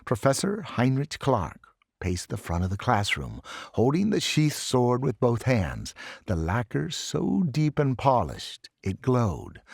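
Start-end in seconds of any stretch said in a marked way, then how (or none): none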